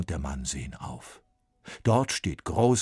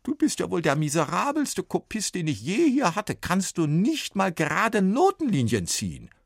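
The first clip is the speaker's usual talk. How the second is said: higher, louder exasperated voice; exasperated scolding; 13 semitones higher, 5 dB louder than usual. A narrator's reading. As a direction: despair over his crooked lines. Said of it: "stöhnt".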